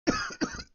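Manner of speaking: harsh real cough; chesty cough. Real, not acted. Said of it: "coughing and gacking here".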